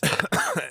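harsh phlegmy cough; coughing fit. Real, not acted. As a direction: cough hard to clear phlegm. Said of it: "sorry for coughing that way".

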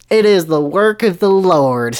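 grand explanation. Acted. Dumbledore justifies the screaming students, slow and grand. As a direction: declare it slowly, with pious certainty. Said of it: "Dumbledore explained accurately".

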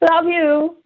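high-pitched, sing-out goodbye, put on; mother-in-law's sign-off. Mother-in-law ending a conversation, insincere high sing-out. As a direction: imitate an insincere high "love you". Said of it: "finishes conversation with".

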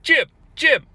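trying to get his attention; caller calling out. Retold or imitated. Caller to Jim Cornette, calling out for attention. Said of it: "the caller's like".

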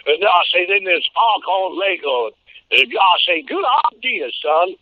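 put-on big boastful rooster lecturing a boy; Foghorn Leghorn voice. Shown as a demonstration. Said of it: "for Sylvester".

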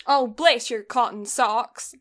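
slow put-on Southern drawl; wobbly mock Southern accent; Southern slipping on socks. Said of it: "accent just twitches on socks".

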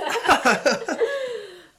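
high, loud burst of laughter; squealing laugh let loose; big laugh. Real, not acted. Host cracking up at her own jibe, high laughing.

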